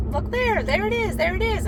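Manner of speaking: high, urgent imitation of the ladies; excited high-pitched quoted calls; excited retelling. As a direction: mimic excited riders spotting something. Quoting the ladies spotting the fox.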